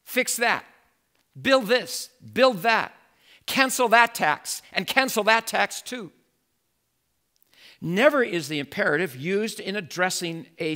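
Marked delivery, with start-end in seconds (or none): 0.0-6.1 raised, higher voice barking orders at the mayor; bossy commands to the mayor. Retold or imitated. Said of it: "One would never go into the mayor's office and start using the imperative".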